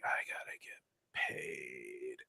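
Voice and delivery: slow, hushed whisper to himself; anxious whisper. Retold or imitated. Musician fretting over collecting pay from the groom, anxious whispering. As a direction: whisper the worry, drawing it out.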